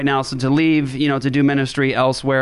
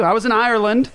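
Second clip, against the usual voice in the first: ordinary talk, nothing marked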